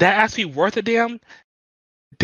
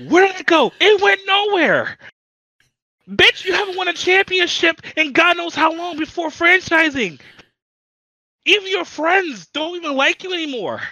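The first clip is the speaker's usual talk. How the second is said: furious rant; 8 semitones higher than usual. Real, not acted. Venting about the rival pro, high and heated. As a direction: breathe fire at him. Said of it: "you were breathing fire".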